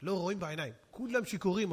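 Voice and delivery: ordinary delivery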